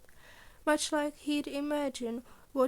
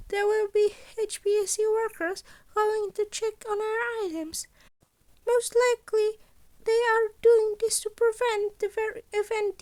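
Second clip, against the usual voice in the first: high, louder principal laying out the heist; Nezu voice; 9 semitones higher, 6 dB louder than usual. Acted. Principal briefing students on the gala heist, high and bright. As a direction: pitch it up like a cheerful small headmaster.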